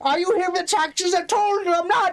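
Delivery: put-on high indignant old lady; paranoid grandma. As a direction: shout like a stubborn granny. Paranoid granny yelling.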